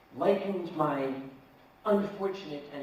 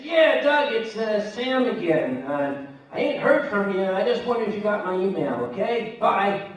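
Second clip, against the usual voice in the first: chummy voicemail; 5 semitones higher, 8 dB louder than usual. Retold or imitated. Voicemail to debtor, hammed up.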